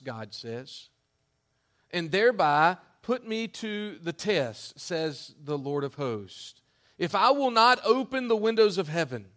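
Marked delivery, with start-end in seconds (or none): 1.9-9.3 loud, high-pitched scripture preaching; fiery preaching. Acted. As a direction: thunder the promise of God. Preaching Malachi at full force.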